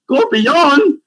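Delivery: high, squeaky what, rising scorpion; disbelief. Retold or imitated. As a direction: sound utterly baffled. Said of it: "we're like".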